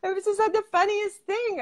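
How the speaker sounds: high-pitched, laughing retelling; retold giggling. Recalling she could not stop laughing, high and giggly. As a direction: let remembered hilarity lift the voice. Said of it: "I just could not stop laughing".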